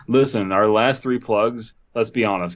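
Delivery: normal talking voice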